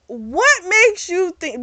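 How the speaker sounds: high-pitched, drawn-out disbelief; slow, indignant squawk of a question; incredulous outburst. Acted. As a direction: stretch it out high, utterly incredulous. Teller's incredulous rant at villain.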